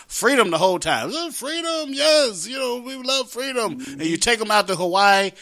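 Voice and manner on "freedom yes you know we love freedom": mock gushing. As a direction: play the delighted enthusiast, pitched up. Acts out gushing about freedom.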